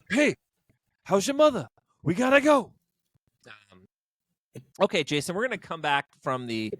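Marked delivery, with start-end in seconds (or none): 0.1-2.7 high, punchy mock tough-guy voice; movie-star impression. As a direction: toss out his catchphrases, raised and emphatic. Mimics the movie star's stock lines.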